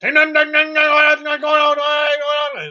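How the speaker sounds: high, loud singsong scolding imitation; mock Chinese scolding.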